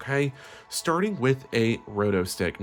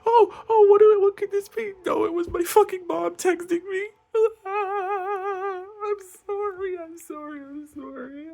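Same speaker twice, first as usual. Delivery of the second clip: much higher, louder, sobbing mock meltdown fading to weepy apologies; hysterical crying rant; 4 dB louder than usual. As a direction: howl it like a comic heartbreak meltdown. Tearful rant about mom's text.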